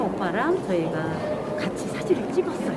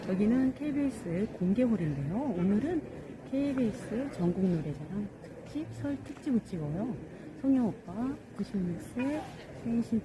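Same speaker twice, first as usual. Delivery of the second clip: soft formal address; 3 semitones lower, 7 dB quieter than usual. Acted. Soft formal greeting to camera.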